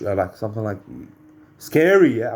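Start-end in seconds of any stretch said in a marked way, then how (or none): 1.6-2.3 loud, high startled yell; retaliating shout. Retold or imitated. Startled speaker retaliating at a cat, sudden shout. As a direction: shout it to scare something off. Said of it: "I retaliated, and I said".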